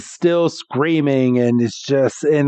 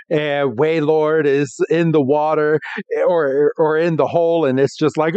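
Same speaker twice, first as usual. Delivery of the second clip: ordinary talk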